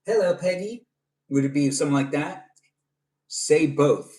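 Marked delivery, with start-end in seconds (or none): 0.0-0.9 high, lilting Southern hello; Southern accent. Acted. Streamer says hi to viewer's mom, Southern accent. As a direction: greet her in a higher Southern voice. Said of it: "has to be in a Southern accent".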